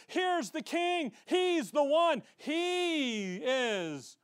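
high, slow, ringing announcement building to a shout; proclaiming the king. Retold or imitated. The Father announcing the king to the nation, rising to a shout. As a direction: cry it out to the crowd like a herald. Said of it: "he looks to the nation and says".

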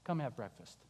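soft low welcome; gentle invitation. Acted. Jesus inviting the disciples to eat, tender and soft.

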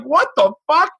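high-pitched, baffled exclamation; shocked outburst. Retold or imitated. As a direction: blurt it high in acted disbelief. Acts out shock at sucker punch.

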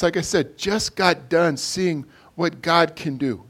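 ordinary talk, nothing marked

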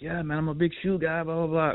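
amateur Australian-accent imitation of a kid; attempted Australian accent. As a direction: try your best Australian accent, badly. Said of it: "he's got that accent".

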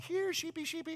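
high sing-song call to the sheep; overeager calling. Retold or imitated. Six-year-old calls the sheep at the fair, overeager. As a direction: coax the sheep, far too eager. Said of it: "probably too loud, a little bit too enthusiastic".

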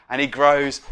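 ordinary talk

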